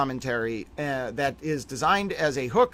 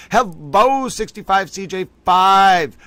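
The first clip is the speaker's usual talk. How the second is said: loud greeting; 6 semitones higher, 8 dB louder, slower than usual. Real, not acted. Greeting chat loudly, far voice.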